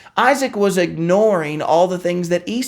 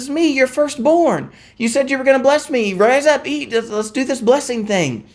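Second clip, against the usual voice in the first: higher, lightly voiced eager son; eager Esau; 7 semitones higher than usual. Esau urges his blind father to bless him, eager and lightly voiced. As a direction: play Esau brushing it off, keen to eat.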